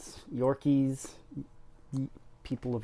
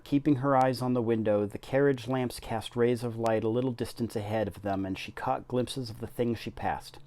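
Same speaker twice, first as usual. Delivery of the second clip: story narration. A narrator's reading. Reading The Secret Garden aloud to BookTube viewers, storybook narration. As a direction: tell the story to the listeners.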